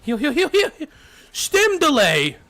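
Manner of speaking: high, loud urgent callouts; frantic shout. Real, not acted. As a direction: yell for a heal, panicked. Frantic teammate callout in firefight.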